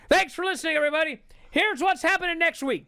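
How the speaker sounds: loud, high imitated commentator; Mike Tenay sign-off. Commentator closing the show for the viewers, loud high sign-off. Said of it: "Mike Tenay goes".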